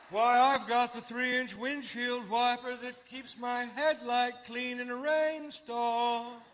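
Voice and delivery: high, slow, drawn-out snob imitation; mock car snob. Imitating a haughty Mercedes owner.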